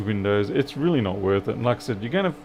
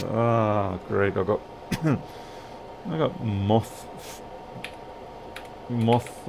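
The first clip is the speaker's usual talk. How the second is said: hoarse, slow, coughing through words; just swallowed a bug; 4 dB quieter, much slower than usual. Real, not acted. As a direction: talk with a bug in your throat. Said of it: "The bug just flew in my mouth".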